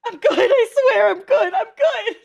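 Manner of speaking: high, loud protest, voice pushed way up; loud insisting. Real, not acted. Crybaby viewer swearing they are fine, loud insisting. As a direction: insist at the top of your voice after crying.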